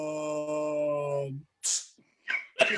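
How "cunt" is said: one word stretched out for seconds; slow motion. Retold or imitated. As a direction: hold the vowel in an absurdly long slow-motion drone. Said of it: "Your hand moved in slow motion".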